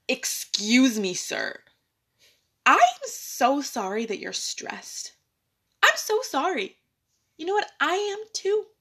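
loud, high, emphatic apology to a rude boss; acted indignant politeness. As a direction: stand up to the boss with pointed politeness. Acts out confronting the owner.

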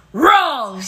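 loud yelled interjection; mock yell. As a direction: yell it out like a heckler. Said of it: "waiting for you to yell at me".

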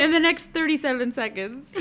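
loud, high countdown pitch; infomercial. Acted. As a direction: rush them with fake urgency. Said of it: "those infomercials".

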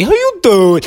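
high, loud catchphrase, swooping down at the end; Wendy Williams. Retold or imitated. Podcast host introducing a talk-show icon by imitating the catchphrase, high and loud. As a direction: belt out the signature greeting high. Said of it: "that would be the one and only".

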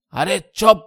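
husband shouting his wife down; furious shout. Acted. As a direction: shut her up with one blast. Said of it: "پوری طاقت سے چلائے".

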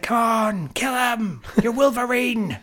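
high, loud cheering for Wolverine to fight back; cheering at the screen. Retold or imitated. Fan yelling at Hugh Jackman on screen, loud high cheering. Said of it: "I'm just like".

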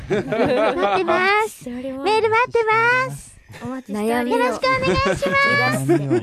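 可愛い子ぶった声. Pretend. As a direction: call out like a cute girl asking for mail. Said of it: "可愛い、可愛い子で言ってけば". (可愛い子ぶった高めの声でおねだり)

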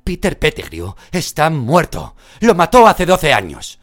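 high, loud disbelieving cry; shocked outcry. Acted. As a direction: shout that he is dead. Hermione shouting in disbelief.

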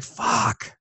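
high, softened retold curse; mock exasperation. Parent retelling the moment afterwards, mock exasperation.

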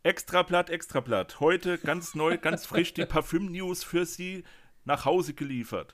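loud, high newsboy call; rapid old-time reporter. Acted. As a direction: shout it like a street paper seller. Newsboy cry for perfume news.

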